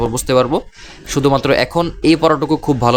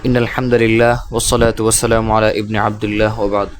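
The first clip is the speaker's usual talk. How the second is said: slow, devout Arabic recitation of blessings; prayer before a Quran lesson, said slowly; slow opening prayer; 2 semitones lower, much slower than usual. Real, not acted.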